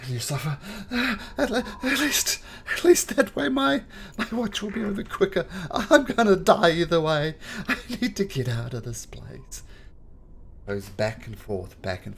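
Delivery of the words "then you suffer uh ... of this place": high, loud, breaking crying wail; sobbing drow. Acted. The drow breaks down before his watching comrades, sobbing. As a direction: fall apart in panicked tears.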